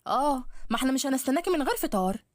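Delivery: a sharp, needling stepmother taunting her stepdaughter; nagging stepmother. Acted.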